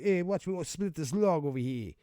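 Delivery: high, loud Boston show-off; mock Boston accent. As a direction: brag in a put-on Boston accent. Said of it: "they wouldn't be talking like that in Boston".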